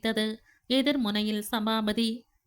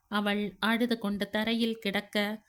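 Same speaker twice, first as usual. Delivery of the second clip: usual speaking voice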